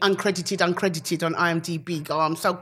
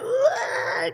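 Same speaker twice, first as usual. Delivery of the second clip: falling yelp. Retold or imitated. Tripping over cables while catching up, a high falling yelp. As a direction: yelp as you grab at air. Acts out her stumbling fall.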